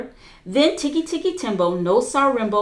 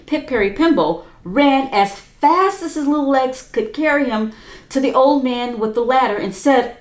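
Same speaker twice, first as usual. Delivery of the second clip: plain reading voice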